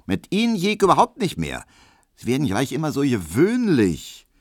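high, loud, sharp rebuff; pert. Acted. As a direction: put him in his place. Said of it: "sagte Hedwig spitz". (spitz und hoch abweisend)

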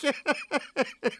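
high, hysterical acted laugh; squealing fit of giggles; roaring laughter. A narrator's reading. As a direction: laugh helplessly, pitch way up. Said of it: "Neumann lachte, dass die Scheiben zitterten".

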